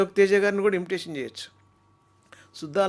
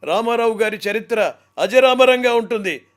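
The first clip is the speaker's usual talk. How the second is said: impression of a speaker; 5 semitones higher, 11 dB louder than usual. Mimicry artist opening with a voice.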